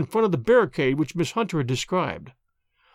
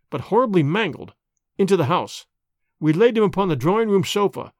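plain reading voice

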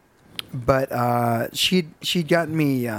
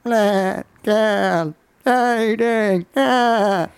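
slow, higher, wavering baa-like bleat; sheep bleating; 7 semitones higher, much slower than usual. Retold or imitated. Teen taunting a coworker with sheep noises, slow mock bleating. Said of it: "I started making sheep noises at her".

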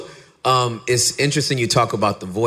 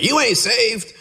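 much higher mock scandalised churchgoer; offended churchgoer; 13 semitones higher, slower than usual. Churchgoers scolding him for how he talks, mock outrage. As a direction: jab it like an outraged saint. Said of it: "people would bristle at and get mad at and be like".

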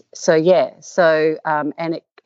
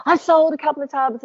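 higher-pitched, mock-excited husband on the phone; excited good news; 11 semitones higher than usual. Husband ringing up his wife with a sale, excited. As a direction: announce the sale in a high, excited voice.

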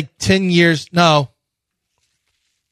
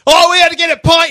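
much higher, louder, faster hammed-up British accent; mock British accent; 13 semitones higher, 5 dB louder, faster than usual. Host mocking a friend back from England, hammed-up British accent. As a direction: ham up a tourist's British phrase. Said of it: "he's doing all the sayings".

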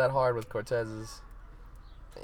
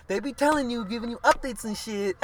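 put-on [name]'s Dominican New York accent, high and loud; a loud, high [name] accent rant; imitating [name]; 11 semitones higher, 4 dB louder than usual. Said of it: "the way in her accent and shit".

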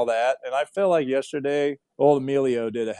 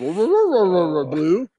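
calling the dog; 3 semitones higher, 5 dB louder than usual. Retold or imitated. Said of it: "is when you say".

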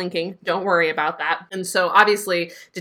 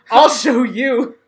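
slow, louder, drawn-out declaration; loud defiant vow; 5 semitones higher, 7 dB louder, much slower than usual. Retold or imitated. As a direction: shout it slowly, like a battle cry. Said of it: "And I was like".

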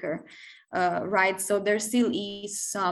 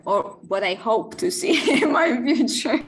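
louder, breaking into a laugh; 3 semitones higher, 7 dB louder than usual. Real, not acted. Guest hoping aloud for the future, laughing. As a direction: say it through a rising laugh. Laughs while voicing a hope.

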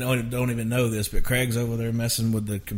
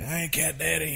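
lightly voiced producer, raised pitch; imitating Craig Wiseman; 5 semitones higher, 4 dB quieter than usual. Songwriter imitates co-writer on the phone, lightly pitched-up voice. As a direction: put on the co-writer's greeting, pitched up. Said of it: "I'm doing a Craig Wise imitation".

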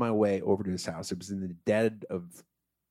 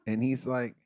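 usual speaking voice

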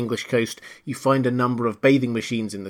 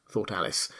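ordinary delivery

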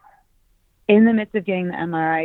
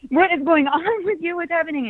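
put-on high, startled kids calling out to mom; kids' voice; 11 semitones higher than usual. Kids asking their mom what's wrong, high and startled. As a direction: play the kids as alarmed, high and giggly. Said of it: "they're like".

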